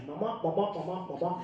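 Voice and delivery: mock muffled wah-wah babble; Charlie Brown teacher. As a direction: drone "mama" like the cartoon teacher. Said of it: "the Charlie Brown teacher".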